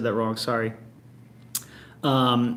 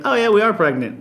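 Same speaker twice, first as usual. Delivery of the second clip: imitated casual announcement, pitched high and louder; matter-of-fact; 8 semitones higher, 5 dB louder than usual. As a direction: drop big news as if it were nothing, imitating a friend. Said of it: "He just said it so matter-of-factly".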